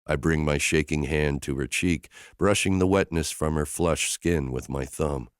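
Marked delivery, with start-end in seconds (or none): none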